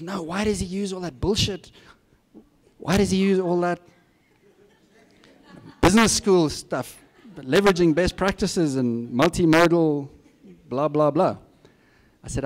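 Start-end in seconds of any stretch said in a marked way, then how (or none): 0.0-11.4 higher, louder, fed-up mimicking; hammed-up disgust at jargon; exasperated. Colleague mocking the business-school jargon, exasperated. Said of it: "He says".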